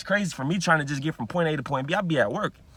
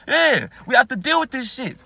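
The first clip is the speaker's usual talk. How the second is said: laughing; 8 semitones higher, 7 dB louder than usual. Real, not acted.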